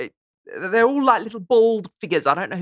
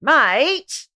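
loud, high, drawn-out Aussie "mate"; annoyed Australian accent, stretched vowel; unhappy Australian mate; 7 semitones higher, 5 dB louder than usual. Shown as a demonstration. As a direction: scold a friend with one long rising Australian "mate". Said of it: "that's not happy".

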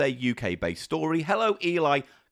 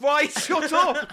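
much higher, louder, drawn-out shout; exasperated shout; 10 semitones higher, 4 dB louder, much slower than usual. Real, not acted. Fed up with her cohost's jokes, shouting him down. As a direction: yell at him to stop.

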